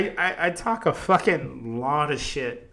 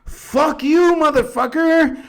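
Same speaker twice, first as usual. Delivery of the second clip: furious stadium yell, much higher and louder; guy yelling; 9 dB louder, slower than usual. Retold or imitated. Heckling fan screaming at the Yankees fans. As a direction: scream it across the stands. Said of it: "all I hear is this guy in the background".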